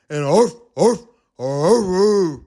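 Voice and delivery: loud arfs; a puppy arfing. Acted. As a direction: arf like a puppy. More dogs bark.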